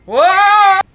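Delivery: very high, loud shriek of disbelief; shocked. Real, not acted. Host shrieking at co-host's remark, very high and loud. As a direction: yelp it in outrage.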